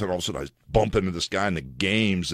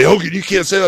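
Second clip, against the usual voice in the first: quoted scolding; 9 semitones higher, 6 dB louder than usual. Retold or imitated. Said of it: "and they say".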